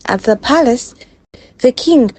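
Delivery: usual speaking voice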